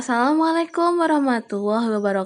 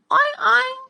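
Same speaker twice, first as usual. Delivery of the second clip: high squeaky oink; pig oink; 13 semitones higher than usual. Pretend. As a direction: oink like a cartoon piglet. Teacher imitating pig grunt.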